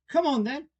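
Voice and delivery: loud, high commanding yell; roared. Acted. Queen summoning Alice into the procession, roaring. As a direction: roar an invitation like an order. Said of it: "roared the Queen".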